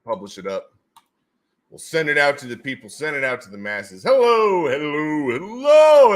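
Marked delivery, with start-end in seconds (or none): 4.0-6.1 loud greeting call. Real, not acted. Greeting calls.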